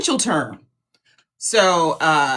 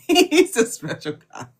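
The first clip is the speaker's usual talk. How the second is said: said through a laugh; 2 semitones higher than usual. Real, not acted. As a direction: repeat it with a chuckle.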